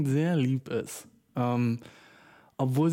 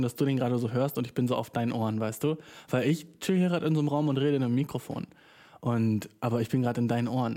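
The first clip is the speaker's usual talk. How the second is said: ordinary delivery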